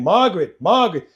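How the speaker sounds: imitating dad hollering for mom; calling out a name. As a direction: holler her name across the house. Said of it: "calling my mother's name".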